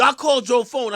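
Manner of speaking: ordinary talk, nothing marked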